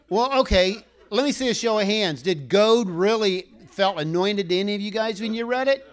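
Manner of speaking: rally the room with a joking question; excited teasing. Real, not acted. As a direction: call out to the congregation, half-joking. Teasing the congregation about goad.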